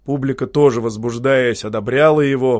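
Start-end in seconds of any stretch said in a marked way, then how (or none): none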